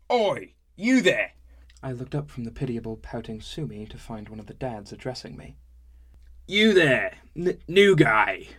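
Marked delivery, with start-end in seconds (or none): 0.1-1.3 put-on loud, high drunken hail; calling out. A drunk dad hailing the new guy across the tavern, loud. 6.5-8.5 put-on loud, high drunken hail; calling out. A drunk dad hailing the new guy across the tavern, loud.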